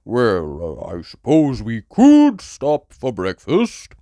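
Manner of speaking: lightly voiced slow, hesitant dad pig; a hint of a dithering father pig; Daddy Pig voice. A narrator's reading. Daddy Pig suggesting breakfast to his hungry family, slow and hesitant. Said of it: "said Daddy Pig".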